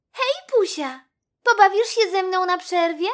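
high, loud, friendly invitation; eager little mouse. A narrator's reading. (wysoki głosik myszki zapraszającej do zabawy)

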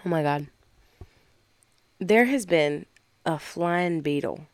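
ordinary talk, nothing marked

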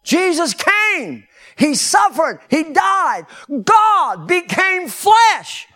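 loud, high, slow, building; preaching at full force. Real, not acted. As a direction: proclaim it to the rafters.

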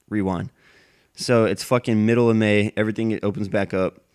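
ordinary talk

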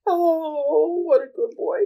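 high-pitched doting coo; cooing over a dog. Real, not acted. Co-host gushes over the chubby dog, cooing. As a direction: gush in a high pet-talk voice.

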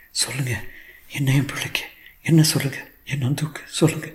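high, half-whispered mother's plea; distraught pleading. Acted. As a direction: beg in a broken, partly whispered voice. Distraught mother begs for the truth. (கெஞ்சும் தாயின் உடைந்த குரல்)

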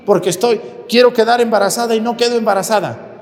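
high-pitched, insistent mock demand; imitating angry woman. Stubborn woman demands the priest's hand, mock angry. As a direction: imitate a pushy, cross woman. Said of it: "pero así a fuerzas, enojada".

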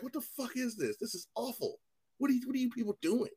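high, hushed mock disbelief; retold dismay. Fan reacting to DC's other movies, high quiet mock dismay. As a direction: act appalled in a small, high voice.

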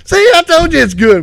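high, loud told-you-so; loud smug friend. Retold or imitated. Kenny smug about cat food.

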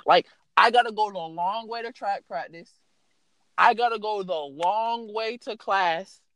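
ordinary talk, nothing marked

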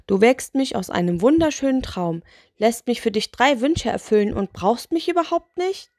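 complain, rising to an outraged question; exasperated spirit. Acted. Spirit learns he wasn't needed, exasperated.

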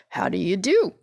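lightly husky scarecrow greeting. Acted. Scarecrow returns the girl's attention with a greeting, lightly husky. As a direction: straw man's greeting, question lifting at the end. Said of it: "in a rather husky voice".

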